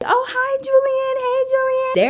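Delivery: put-on very high, loud girly greeting; gushing receptionists. Front-desk girls greeting the teen patient, gushing. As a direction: squeal hello in a put-on, sugary high voice.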